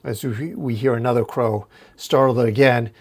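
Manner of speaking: usual speaking voice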